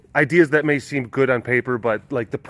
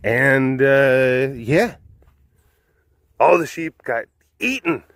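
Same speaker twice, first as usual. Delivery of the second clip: plain reading voice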